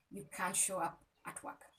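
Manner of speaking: ordinary talk, nothing marked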